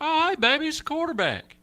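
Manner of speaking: high, gushing sweet voice; starstruck. Retold or imitated. Homeowner spotting the quarterback at the door, high and gushing. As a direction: melt when you see who it is. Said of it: "You'd be like".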